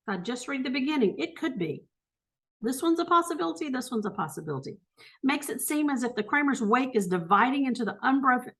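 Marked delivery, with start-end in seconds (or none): 2.6-3.7 higher and quick, hopeful; brighter first take. Real, not acted. Weighing answer choices aloud. 3.7-4.8 lower, quick, much softer aside; softer second take. Real, not acted. Repeats to herself, softer.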